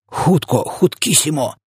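high, urgent hurry-up call; excited urging. A narrator's reading. Giant rushes Sophia for the jar, excited high cry. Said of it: "крикнув він".

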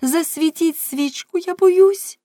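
high, loud frightened plea; frightened cry. A narrator's reading. Girl crying out to Sooktin in fear. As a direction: cry out in terror for light. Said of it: "і крикнула".

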